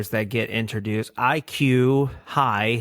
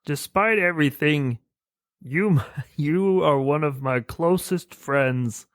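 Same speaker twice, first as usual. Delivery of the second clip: high-pitched cartoon alien voice; squeaky little Martian, earnest; Marvin the Martian; 5 semitones higher than usual. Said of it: "And Marvin the Martian goes".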